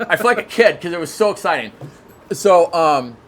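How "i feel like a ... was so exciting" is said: usual speaking voice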